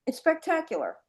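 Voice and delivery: first take. Shown as a demonstration. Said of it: "let me say that again".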